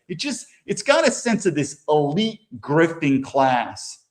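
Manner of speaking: ordinary delivery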